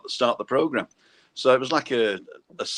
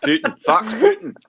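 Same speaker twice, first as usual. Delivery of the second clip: higher, laughing mock Scottish "Fax Putin"; Scottish accent; 6 dB louder than usual. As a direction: call back the Scottish catchphrase. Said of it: "Scottish accent".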